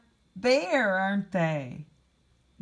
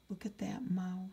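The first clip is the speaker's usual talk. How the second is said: whisper; 9 dB quieter than usual. Acted. Storyteller hushes to the children.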